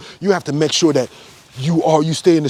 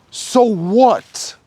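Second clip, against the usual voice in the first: high, dismissive so what; sarcastic high dismissal; scornful so what; 8 semitones higher than usual. Real, not acted. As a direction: wave the claim away with contempt. Dismissing claimed superiority.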